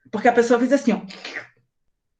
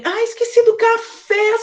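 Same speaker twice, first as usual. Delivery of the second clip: sudden realisation; 12 semitones higher than usual. Real, not acted. Suddenly remembers her coffee.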